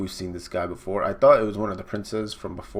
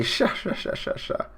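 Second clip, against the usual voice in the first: high "sha sha" cackle trailing off; hammed-up signature cartoon laugh; villain laugh; 12 semitones higher than usual. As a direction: laugh on repeated "sha" syllables.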